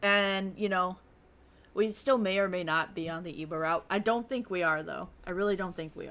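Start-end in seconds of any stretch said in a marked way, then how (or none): none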